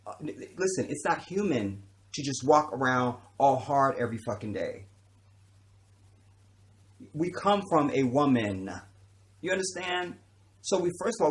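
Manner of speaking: normal talking voice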